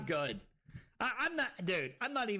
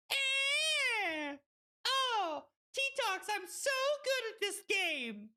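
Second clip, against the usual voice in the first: very high, squealed brag; gleeful boasting; slower than usual. Real, not acted. Streamer crowing about their skill, gleeful boast. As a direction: crow in a high voice. Gloating over a game turn.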